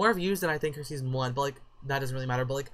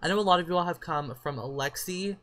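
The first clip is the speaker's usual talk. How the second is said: normal talking voice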